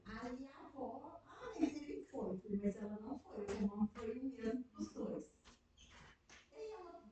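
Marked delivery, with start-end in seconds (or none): none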